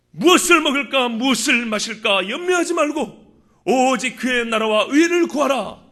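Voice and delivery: forceful sermon delivery with emphatic quotations; impassioned preaching. Acted. Preacher quotes Jesus urging the congregation to believe, impassioned preaching. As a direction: drive the faith message home to the congregation.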